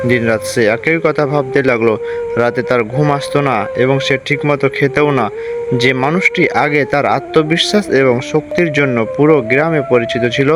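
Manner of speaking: ordinary delivery, nothing marked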